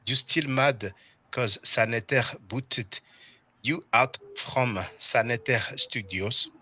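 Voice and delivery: put-on slow, joking French accent, French R and vowels. Reading a viewer's comment aloud for fun, French accent. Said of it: "I was about to speak like this with a French accent".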